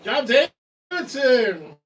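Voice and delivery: slow, loud, high shout; drawn-out shout. Real, not acted. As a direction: call the name out long and loud. Calling out the name.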